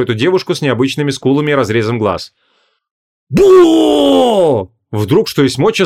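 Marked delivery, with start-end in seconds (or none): 3.3-4.7 high, loud startling shout; sudden yell. Acted. Marquise yells to startle the possessed princess, loud shout. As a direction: jump out and yell to scare her. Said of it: "заорала Вон".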